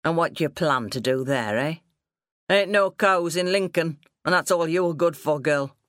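a hint of a gruff country father; lightly voiced scornful farmer father. Father mocking daughter's plan for Lincoln, mildly scornful. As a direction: belittle her in dialect, mildly scornful.

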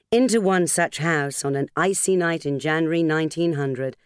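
usual speaking voice

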